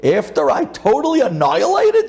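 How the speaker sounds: high, dismissive bragging; scoffing Kasparov. Acted. Kasparov scoffs at IBM's rematch call, high and dismissive. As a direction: wave away the rematch. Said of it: "Kasparov is laughing".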